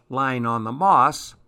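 ordinary delivery, nothing marked

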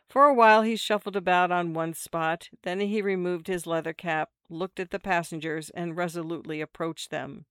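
plain reading voice

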